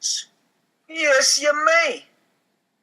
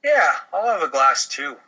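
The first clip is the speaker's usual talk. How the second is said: usual speaking voice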